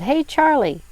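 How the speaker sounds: high, loud call for attention. A narrator's reading. Wanda calling to Charlie in the tent, calling out. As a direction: hail someone across the yard. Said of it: "She called".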